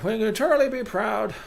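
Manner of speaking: high, ceremonial speech voice; pompous council head. Acted. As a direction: address the colony in a high, grand voice.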